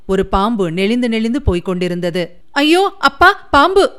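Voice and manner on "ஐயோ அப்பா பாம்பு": high, loud cry of fright at a snake; terrified shout; frightened scream. A narrator's reading. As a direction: shriek in sudden terror. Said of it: "என்று சிவகாமி அலறிக்கொண்டு".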